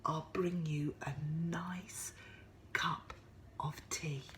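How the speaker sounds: gentle whisper. Acted. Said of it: "said Missus Bear".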